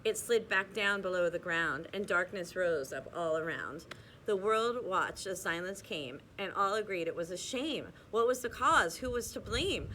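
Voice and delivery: lilting read-aloud storybook voice; cosy bedtime storyteller, rhythmic; story narration. A narrator's reading. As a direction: read the rhymes to children, bouncing the beat. Reads rhyming picture book aloud.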